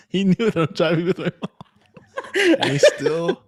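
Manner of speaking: high, loud words laughed out; laughing. Real, not acted. Telling his friend about the calls, real laughter. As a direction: laugh through the words, tickled.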